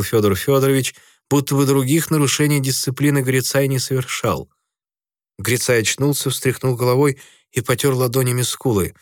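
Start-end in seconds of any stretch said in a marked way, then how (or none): none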